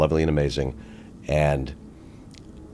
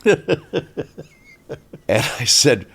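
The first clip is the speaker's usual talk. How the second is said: laughing through the words; chuckling as he tells it; 11 semitones higher, 3 dB louder, much slower than usual. Real, not acted. Laughing while retelling.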